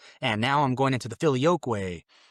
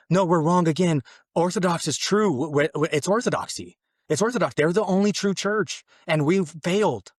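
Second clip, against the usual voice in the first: urgent insistence; 5 semitones higher, 4 dB louder than usual. Retold or imitated. Insists to his wife he was wrong.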